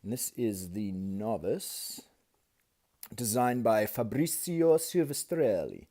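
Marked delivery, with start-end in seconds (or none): 3.1-5.9 slow, hammed-up Italian accent on the name; mock Italian accent. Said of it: "That's perfect Italian accent".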